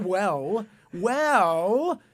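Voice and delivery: high, drawn-out, rising "well"; knowing irony. Acted. Storyteller hinting at the disaster, loud and ironic. Ironic answer to their dismissal.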